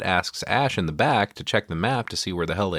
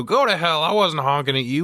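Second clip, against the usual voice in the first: mock yell; 9 semitones higher than usual. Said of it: "Scott yells out the window".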